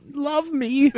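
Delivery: very high put-on needy plea; mock pleading. Player joking along about wanting adulation, high mock plea.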